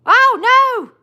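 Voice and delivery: high, loud "oh no" yelp; dismayed cry. Real, not acted. Streamer fumbling to add a moderator, dismayed cry. Mishap while adding a moderator.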